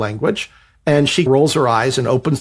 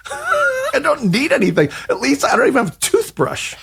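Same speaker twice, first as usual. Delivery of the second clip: very high squeaky mock repeat; incredulous echo; 13 semitones higher than usual. Writer echoing the agent about no toothbrush, squeaky. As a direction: parrot him back in a squeal.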